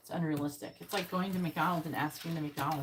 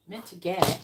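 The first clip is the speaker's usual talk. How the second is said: scornful echo; 7 dB louder, slower than usual. Shown as a demonstration. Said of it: "I don't like that wording".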